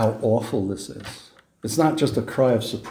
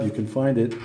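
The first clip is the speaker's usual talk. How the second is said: usual speaking voice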